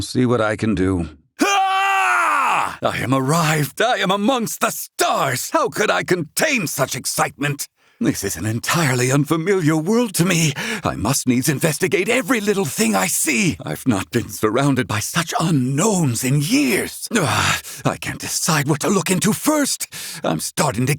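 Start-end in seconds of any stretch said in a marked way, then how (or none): 1.4-2.8 a high, far-flung cry of wonder; joyous cry. Acted. Old scholar arriving in space cries out with joy. Arriving among the stars, overjoyed. 2.8-19.9 higher, eager and bubbling over; can barely contain the excitement; giddy wonder. Acted. Old scholar marvels at the unfamiliar stars, giddy and excited.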